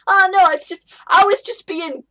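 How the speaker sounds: hammed-up innocent rogue voice; goofy rogue. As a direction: play it like a caught-out goofball. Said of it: "the rogue going".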